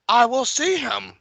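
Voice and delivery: put-on high, slow old man voice; frail old king voice. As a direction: quaver it like a feeble old king. Voicing old king Viserys.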